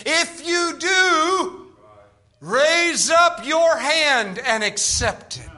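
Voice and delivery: loud, drawn-out preacher's call; re-enacted healing-line call, slow and loud; imitated altar call. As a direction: stretch each word out in a big slow summons. Retold altar call to the lady.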